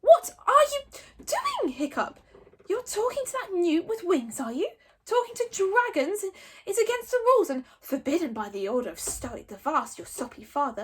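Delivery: mock him with contempt; sneering. A narrator's reading. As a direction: Snotlout sneering at Hiccup. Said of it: "sneer at Hiccup".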